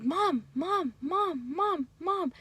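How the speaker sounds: high, repeated nagging calls of Mom; a kid pestering for attention; whiny child calling mom. Retold or imitated. Child pestering mom for attention, whiny calls.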